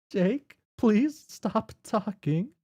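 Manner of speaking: exasperated player character cutting him off; fed-up plea. Acted. Jane snapping at Jake.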